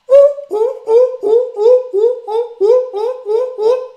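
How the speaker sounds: very high, loud, fast barking ooh sounds; seal bark. Shown as a demonstration. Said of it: "and they go".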